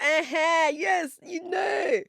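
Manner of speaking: high, loud, slow teasing "yes, you know"; playful squeal. Retold or imitated. Playful reply to Goliath joke.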